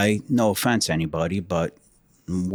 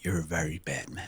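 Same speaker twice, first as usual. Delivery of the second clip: very soft, low teasing imitation; barely voiced mock reproach; mimicked meek accusation; 6 semitones lower, 12 dB quieter than usual. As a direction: drop it low and hushed, mimicking. Friend mimics the subdued man.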